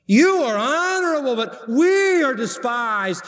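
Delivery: high, drawn-out mock honour; sarcastic. Preacher as Paul comparing apostles with Corinthians, sarcastic. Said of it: "watch how sarcastic he gets".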